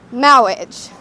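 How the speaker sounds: MARRIAGE said as "maw-widge", r softened to w; comic clergyman lisping MARRIAGE with w for r; mock speech impediment. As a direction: draw out "Marriage" pompously as "mawwage". Mock-solemn poem title announced.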